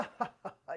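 soft imitation of a friend's chuckle; imitated mocking laugh. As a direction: mimic his needling ha-ha-ha, quietly. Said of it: "he gives that laugh".

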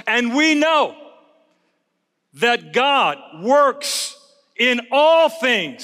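drawn out, each word weighted; slow emphatic preaching. Acted. Pastor repeating Romans to the church, slow and weighty. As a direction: hammer the promise slowly.

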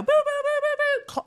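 very high, hooting siren noise; shrill-pitched mock siren; imitated alarm. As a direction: mimic an alarm with repeated high boops. Imitating the bank's alarm going off.

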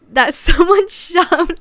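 words breaking into real laughter, slowed; laughing. Real, not acted. To viewers about a rat photo, laughing. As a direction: laugh through it, barely getting words out. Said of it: "I was laughing so hard".

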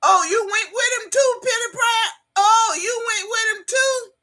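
high, loud, incredulous questioning; mock shock. Streamer confronting viewer over shared man, mock shock. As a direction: pitch it way up in playful disbelief.

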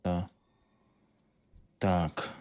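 normal talking voice

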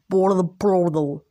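drawn-out, slowed words; odd slow delivery. Real, not acted. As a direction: stretch out world portal oddly. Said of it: "why did I speak like that".

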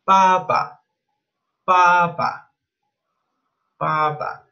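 "papa" said slowly in Mandarin tones; Chinese word. Shown as a demonstration. Teacher showing the Chinese word for dad. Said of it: "en chino se dice papa".

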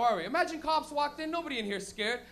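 normal talking voice

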